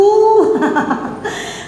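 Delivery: high burst of laughter; high-pitched chuckling peal. Real, not acted. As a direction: laugh out high after clowning. Laughs after comic song.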